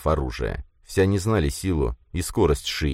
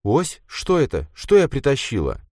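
higher, baffled questioning; puzzled; 8 semitones higher than usual. A narrator's reading. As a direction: ask in confused surprise.

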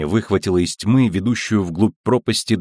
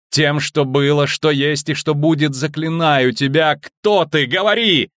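rising incantation; 5 semitones higher, slower than usual. Acted. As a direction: chant the spell, building. Triss casting an incantation. (нарастающее заклинание)

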